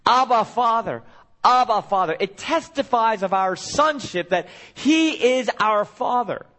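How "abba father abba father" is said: crying out. Retold or imitated. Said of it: "to have us cry out from within".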